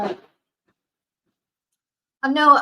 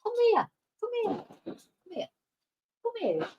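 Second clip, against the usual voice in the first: coaxing dog; 9 semitones higher, 8 dB quieter, faster than usual. Real, not acted. Coaxing dog onto her knee.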